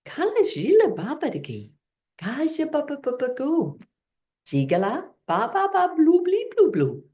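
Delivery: playful curious babble; curious gibberish. Shown as a demonstration. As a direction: ask questions in made-up words. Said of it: "And you can do curious gibberish".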